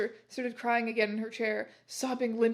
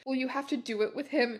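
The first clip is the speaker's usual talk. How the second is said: ordinary delivery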